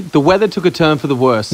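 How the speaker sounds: ordinary delivery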